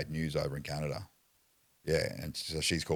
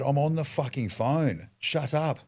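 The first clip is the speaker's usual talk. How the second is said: high, loud, slow yell of shut up; retold shout; 10 semitones higher, 5 dB louder, slower than usual. Stuck in the pool, yelling at the cop, high and loud. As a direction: bark it at the cop from the water.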